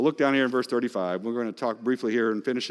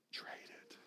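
breathy whisper, barely voiced; hushed urging whisper; 28 dB quieter than usual. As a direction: lean in and whisper the dare. Whispered again after joke.

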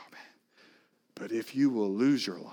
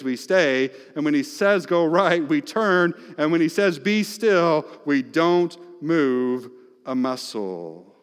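ordinary talk, nothing marked

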